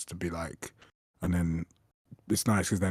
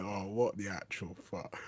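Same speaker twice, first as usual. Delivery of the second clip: muttered disbelief; 7 dB quieter, slower than usual. Retold or imitated.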